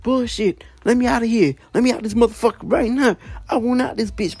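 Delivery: hammed-up angry protest at restrained volume; retold pissed-off ranting. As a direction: imitate a roommate cursing to be let out. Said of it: "starts getting all pissed off".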